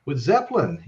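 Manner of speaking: high, amazed gasp at finding the tapes; light up like you just struck gold; imagined excited discovery. Retold or imitated. A tape archivist stumbling on the lost jam, amazed. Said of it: "it's like".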